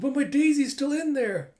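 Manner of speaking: high, loud, distraught protest; acted panicked plea. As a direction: plead like a frantic dog owner. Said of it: "running up screaming".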